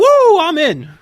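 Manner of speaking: loud, high-pitched wrestler's whoop; Ric Flair. Acted. Podcast host imitates wrestler accepting a comeback match, whooping loudly. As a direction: holler it as a hammed-up showman's catchphrase. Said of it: "Ric Flair be like".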